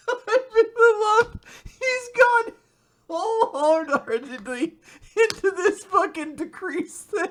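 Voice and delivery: high, squeaky laughing voice; helpless laughter breaking up the words; laughing hard. Real, not acted. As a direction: barely get it out through laughter. Cracking up over the character.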